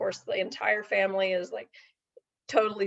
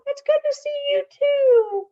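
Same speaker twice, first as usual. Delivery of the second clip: much higher, louder puppet voice; 8 dB louder than usual. Acted. Puppet greeting the host, much higher puppet voice. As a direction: pop up bright as a little puppet.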